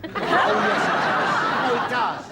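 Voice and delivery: ordinary delivery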